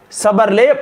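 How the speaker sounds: normal talking voice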